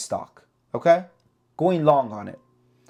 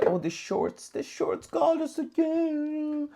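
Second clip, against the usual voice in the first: high, slow whiny mock; whiny put-on voice blaming the shorts; mocking complainer; 11 semitones higher, 5 dB quieter, slower than usual. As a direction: mimic a crybaby trader moaning. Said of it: "I'm not the type to say".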